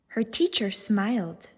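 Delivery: plain reading voice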